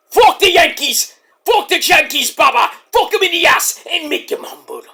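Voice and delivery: hammed-up sports outburst; mock fan rant. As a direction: shout it like a rowdy rival fan. Escalates into a hostile fan rant.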